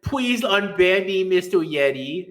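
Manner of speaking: slow, high, mocking beg of a banned troll; whiny plea. Mocking a banned troll begging Mister Yeti for an unban, slow whine. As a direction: draw out the plea like a sulking kid, mocking. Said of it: "within five minutes".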